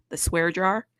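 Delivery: ordinary talk, nothing marked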